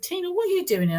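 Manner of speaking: high, startled question to a familiar face; imitating the doctor's shock at seeing her; surprised doctor. Doctor surprised to find Tina at partner's appointment, high startled.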